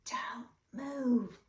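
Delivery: tense unvoiced whisper. A narrator's reading. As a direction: breathe it out, don't let the cat hear. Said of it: "whispered Mister Little".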